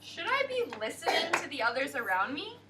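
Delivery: ordinary talk, nothing marked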